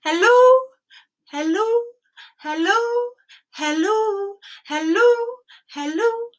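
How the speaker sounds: high, loud repeated calls; shouting hello. Shown as a demonstration. Storyteller leading children to shout back, loud calls. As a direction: shout each hello out for the kids to echo. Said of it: "You can shout back".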